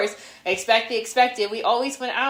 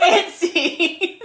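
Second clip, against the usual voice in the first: laughing echo; 7 semitones higher, 4 dB louder than usual. Real, not acted.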